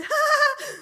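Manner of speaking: nervous fake chuckle shown; showing how she laughed at the pastor; forced laugh. Shown as a demonstration. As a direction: demo an awkward laugh. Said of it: "like this".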